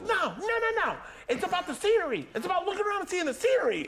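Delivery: very high yelling imitation; mock indignant protest. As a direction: argue back in an outraged high voice. Friend argues about tubing.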